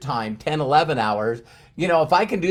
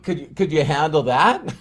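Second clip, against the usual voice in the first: normal talking voice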